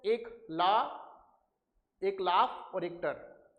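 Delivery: ला and टर split apart, very slow; teacher spelling out syllables. Shown as a demonstration. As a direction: break laughter into ला and टर slowly. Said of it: "दोनों में दो दो syllables हैं".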